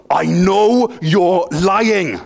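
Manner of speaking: a mock stern headmaster, each word dragged out; headmaster yelling. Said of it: "as he yelled at me".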